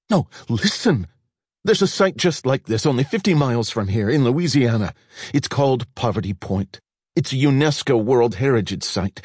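desperate to convince him; excited pleading. A narrator's reading.

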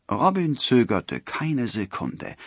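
plain reading voice

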